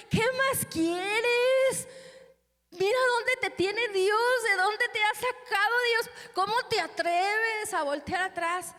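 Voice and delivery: reproche alto, fuerte y lento; regaña como quien sacude a alguien; regaño furioso. Retold or imitated. Regaña a miembros, gritando. (predicadora regañando a un miembro: reproche fuerte y lento)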